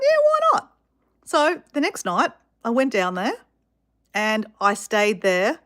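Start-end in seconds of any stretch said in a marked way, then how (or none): 0.0-0.7 high, loud, breezy retold yes; mock-bright jump at the offer; eager acceptance. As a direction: pitch it way up, acting out an eager yes. Retells jumping at job offer.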